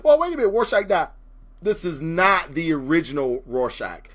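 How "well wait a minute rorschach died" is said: higher and fast, a puzzled fan objecting; quick surprised objection. Pretend. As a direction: blurt it out like a confused reader. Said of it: "you're like".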